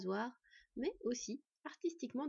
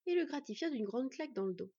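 usual speaking voice

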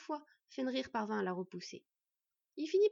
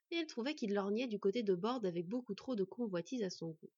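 ordinary delivery, nothing marked

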